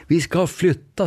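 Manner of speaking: high, slow and proud; grand announcement. Retold or imitated. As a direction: unveil it like big news. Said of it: "säger han".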